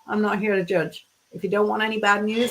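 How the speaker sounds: usual speaking voice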